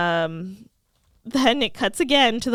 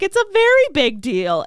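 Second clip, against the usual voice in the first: high, loud, insistent retort; acted-out friend, pressing the point hard; emphatic insistence; 7 semitones higher, 6 dB louder than usual. Willow insisting to Buffy that it matters, emphatic.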